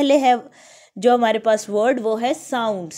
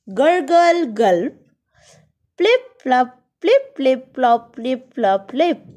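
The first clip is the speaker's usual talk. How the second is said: sound words read aloud; 4 dB louder than usual. Shown as a demonstration. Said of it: "say the following words loud".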